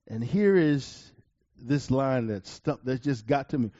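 ordinary talk, nothing marked

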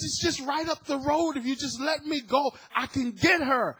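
high, loud pleading with the officer; urgent begging. Retold or imitated. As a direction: push hard, begging to get through.

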